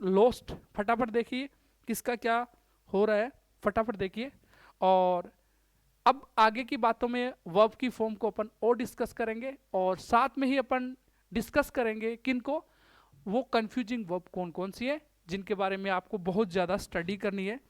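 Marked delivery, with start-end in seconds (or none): none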